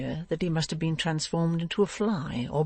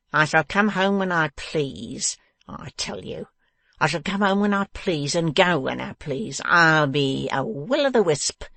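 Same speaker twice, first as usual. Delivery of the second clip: slow, menacing villain voice; taunt, building to a forceful dismissal; gloating bully of a husband; grinning, taunting dwarf. A narrator's reading. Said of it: "grinned the dwarf".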